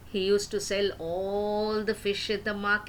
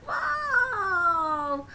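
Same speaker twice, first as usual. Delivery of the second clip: surprised wow; 10 semitones higher, much slower than usual. Acted. Fishmonger sees a cottage.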